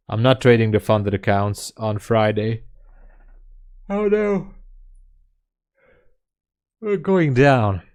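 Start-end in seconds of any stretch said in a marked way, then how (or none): 3.9-7.8 high, hammed-up alarm; playful doom cry; mock panic. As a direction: wail it like the ship is sinking. Mock panic over trade dropping.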